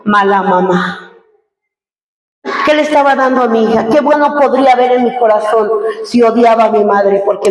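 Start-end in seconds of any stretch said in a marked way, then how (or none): 0.0-1.1 lower, slow and grave divine verdict; voice of God. Retold or imitated. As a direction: pronounce it like a stern judgment from above. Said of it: "me dijo". (voz de Dios grave y lenta, juicio severo)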